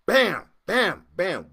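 loud, high falling "bam" hits; imitated slamming. Storyteller acting out the locksmith pounding the safe, loud slams. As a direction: punch each bam hard, like slamming metal down.

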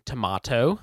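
"tomato" said tuh-MAH-toh; tomato, "ah" vowel. Shown as a demonstration. Second host giving his own rating, "tomato" as tuh-MAH-toh. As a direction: say "tomato" with an open middle "ah". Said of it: "That was the A plus".

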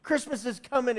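put-on high, bright-eyed child gushing; excited kid. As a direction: gush like a kid waiting for Christmas. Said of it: "really excited about things".

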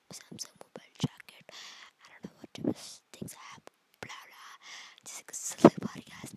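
ASMR style whispering. Acted. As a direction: whisper close to the mic.

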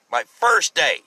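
"first" punched up high; stress on first. Real, not acted. Storyteller stressing he was new at work, "first" punched high. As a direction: lean hard on "first", pitch jumping up. Repeats it for emphasis.

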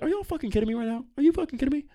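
high, indignant outburst; heated disbelief. Real, not acted. Host about to call up the school, heated disbelief. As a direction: demand an answer, voice shooting up. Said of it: "I'm getting heated".

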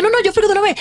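high, insistent mock voice; mimicking an insistent friend; imitated insistence. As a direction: mimic the friend's quick refusal.

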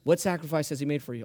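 usual speaking voice

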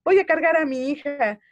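put-on mother's defiant vow to hold her baby; defiant declaration. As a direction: declare it with resolve. Defiant decision retold.

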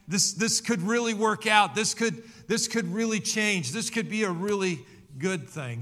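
high, loud eager rush of hope; hopeful excitement. Acted. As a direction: talk yourself into believing it might work. Peter's hopeful thoughts continue.

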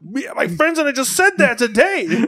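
loud, very high, squealed exclamation; amazed outburst. Retold or imitated. Telling streamer of a shared opinion, amazed outburst. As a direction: blurt it high through laughter.

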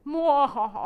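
loud, hammed-up villain cackle; evil laugh.

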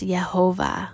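"Yehovah", opening on "Yeh"; read as Yehovah. Shown as a demonstration. Teacher reads out the name for her listeners, "Yehovah" with a Y. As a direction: say "Yehovah" with a Y sound at the start. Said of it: "it should be read as".

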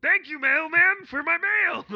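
high, loud, strained cartoon voice; future Andy voice. Future Andy greeting the mailman in a squeal. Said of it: "talk like this at all times".

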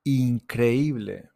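Increíble, drawn out syllable by syllable; slow model word. Shown as a demonstration. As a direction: spell out «Increíble» slowly for learners. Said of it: "slow model pronunciation".

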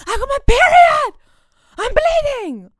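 high, loud thrilled cry; overjoyed yelling to mum; excited shout. Retold or imitated. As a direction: burst in announcing it with joy. Running to mum, excited announcement.

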